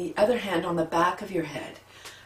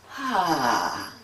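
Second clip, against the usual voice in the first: release sigh; 3 semitones lower than usual. Shown as a demonstration. Guided breathing, releasing breath.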